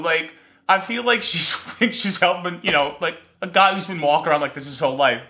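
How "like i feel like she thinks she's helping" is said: ordinary talk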